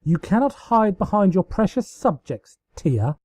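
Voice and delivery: high, gleeful villain taunt. A narrator's reading. Luna taunting her sister in glee. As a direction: crow it with glee. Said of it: "Luna howled in glee".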